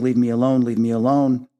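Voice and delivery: usual speaking voice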